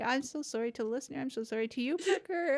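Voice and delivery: high, tearful apology that keeps breaking into sobs; crying. Real, not acted. Host crying while apologizing to listener Parker. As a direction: let the tears take over the words. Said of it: "I didn't expect to need these tissues".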